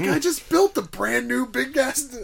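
excited. Retold or imitated. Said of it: "It's like".

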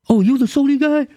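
high, squeaky put-on voice, taken aback; little manager. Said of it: "this little dude who's a manager".

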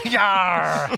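loud rising pirate cry; pirate yell. Acted. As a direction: roar it like a pirate. GM voices pirate again.

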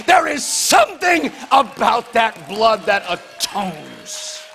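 loud, high, rising preacher's shout; full-force preaching. Acted. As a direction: whip the crowd up, phrase by phrase.

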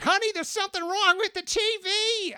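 very high mock housewife voice; woman calling her husband about the broken TV, very high mock voice; confused housewife. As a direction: squeak it like a puzzled wife calling her husband. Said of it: "she's like".

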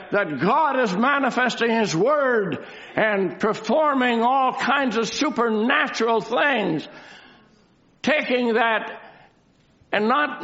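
declamatory, building to pointed questions; emphatic preaching. Real, not acted. Preacher asking believers whether they have it, emphatic preaching. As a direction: press each challenge on the listeners.

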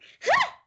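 short, strained high laugh; nervous pained laugh. Shown as a demonstration. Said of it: "it would sound like more like a pained laugh".

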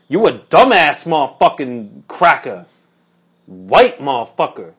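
loud, punchy mock insults; imitated anger. Angry woman repeating her insults at the narrator, mad. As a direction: spit out the insults loudly, word by word. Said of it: "really angry".

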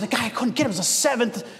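high, exasperated boxer voice, laughing at the end; lightly voiced boxer griping about his opponent; imitating the boxer. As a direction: vent like a frustrated fighter mocking his rival. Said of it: "He said".

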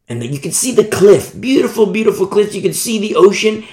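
ordinary talk

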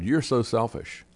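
ordinary talk, nothing marked

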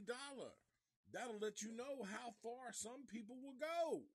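high-pitched, exasperated outrage; incredulous, high-voiced disgust; scornful rant. Real, not acted. Scornful rant at the greedy mayor.

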